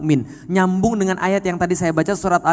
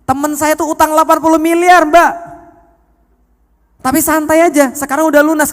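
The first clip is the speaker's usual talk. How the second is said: emphatic reassurance; 9 semitones higher, 7 dB louder than usual. Retold or imitated. Preacher reassures woman in retold talk.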